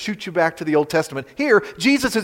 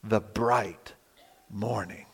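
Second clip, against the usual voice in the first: fading to a breath on star; awed hush; 7 semitones lower, 11 dB quieter, slower than usual. Real, not acted. Preacher awed by the bright morning star, fading to a hush. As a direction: let it die away into awe.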